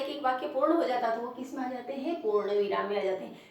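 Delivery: normal talking voice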